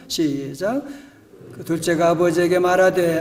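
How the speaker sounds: usual speaking voice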